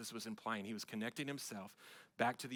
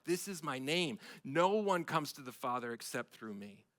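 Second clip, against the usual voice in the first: loud, driving sermon voice; emphatic pulpit delivery, building and insistent; full-force preaching; 3 semitones higher, 6 dB louder than usual. Acted. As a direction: preach it out to the congregation.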